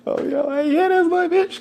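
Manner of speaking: high, squealing proud cry; proud excited boast. Real, not acted. Bragging about his loyal girlfriend, high excited squeal. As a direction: brag with delight. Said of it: "proud boast about girlfriend".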